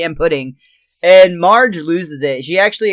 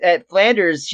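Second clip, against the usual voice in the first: ordinary talk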